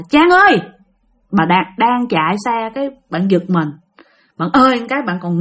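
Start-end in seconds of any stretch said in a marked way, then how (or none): 0.1-0.6 name called out higher; calling out. Retold or imitated. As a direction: call to someone by name, voice lifted. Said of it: "kêu rằng á là".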